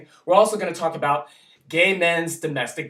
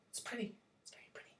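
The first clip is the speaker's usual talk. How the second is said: hushed, barely voiced; whisper; 5 semitones higher, 26 dB quieter, much faster than usual. Vlogger whispering in awe at the flag. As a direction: breathe it out in awe.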